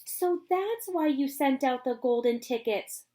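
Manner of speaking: a sudden realisation; cried. Acted. Charlie realises why Wonka sent tickets, excited. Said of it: "cried Charlie".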